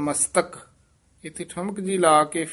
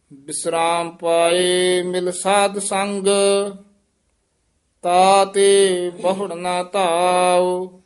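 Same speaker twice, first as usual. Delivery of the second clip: slow, drawn-out Gurbani reading; scripture recitation; 2 semitones higher, 5 dB louder, much slower than usual. Acted. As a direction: linger on line ends like a chant.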